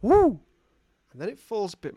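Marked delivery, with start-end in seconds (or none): 0.0-0.4 high, loud single owl hoot; comic owl cry. Acted. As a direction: imitate an owl with a sudden hoot. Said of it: "And the owl goes".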